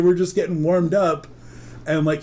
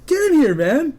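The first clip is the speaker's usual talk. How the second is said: high, loud beckoning; urgent calling out; 14 semitones higher, 6 dB louder than usual. Retold or imitated. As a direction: holler a friend over to come inside. Friend calls him inside.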